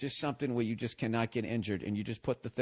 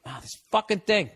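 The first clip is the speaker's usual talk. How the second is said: higher, louder burst of irritation; temper tantrum; 7 semitones higher, 5 dB louder than usual. Real, not acted. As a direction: lash out at the gadget. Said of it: "I'm throwing a temper tantrum here".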